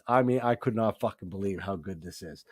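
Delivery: ordinary talk, nothing marked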